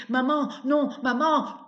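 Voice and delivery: high, loud pleading cry; man crying out. Acted. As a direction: beg her to stop, shouting. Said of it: "Une voix d'homme crie".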